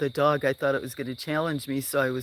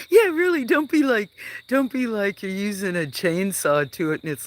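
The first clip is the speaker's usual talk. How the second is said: much higher, louder burst of amused outrage; excited outburst; 7 semitones higher, 5 dB louder than usual. Real, not acted. Reacting to a viewer trimming violently, loud and high. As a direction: blurt it out, cracking up at the idea.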